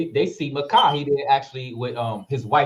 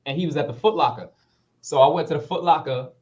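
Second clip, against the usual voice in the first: ordinary talk, nothing marked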